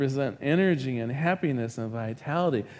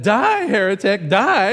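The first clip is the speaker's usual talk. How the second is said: higher, louder mock battle cry; hammed-up zealot condemning a heretic; mock fury; 5 semitones higher, 8 dB louder than usual. Preacher voicing himself in the joke, mock furious shout at heretic. Punchline, shoving man off bridge.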